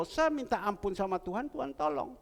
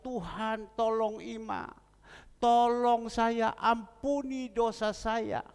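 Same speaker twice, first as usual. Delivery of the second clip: drawn-out pleading cry, higher; retold crying-out prayer; 6 semitones higher, 3 dB louder, slower than usual. Husband crying out to God for his dying wife, pleading. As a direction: cry out slowly to God for mercy. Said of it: "Saya berdoa dengan berteriak".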